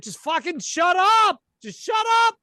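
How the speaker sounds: furious high shout out of a nightmare; screaming. Pretend. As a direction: scream at the knocking to stop. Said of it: "you start screaming".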